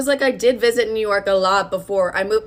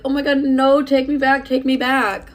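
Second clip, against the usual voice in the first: mock heartbroken plea; 5 semitones higher than usual. Imagined ex begging her back after the breakup, hammed-up pleading. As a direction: play the dumped ex begging dramatically. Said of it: "expecting this man to be heartbroken".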